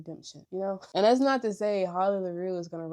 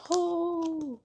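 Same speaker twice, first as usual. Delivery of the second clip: gushing sigh; 11 semitones higher, 3 dB louder than usual. Real, not acted. Gushing about the book.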